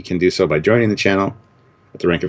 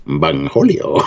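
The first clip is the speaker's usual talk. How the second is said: Cornholio voice; 7 semitones higher, slower than usual. Retold or imitated. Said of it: "the great Cornholio".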